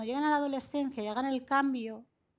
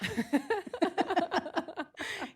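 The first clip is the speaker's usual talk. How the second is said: a bright high burst of real laughter; warm laugh; 8 semitones higher, 3 dB louder than usual. Real, not acted. Mother laughing at what her daughter says to her. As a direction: laugh fondly at your own story.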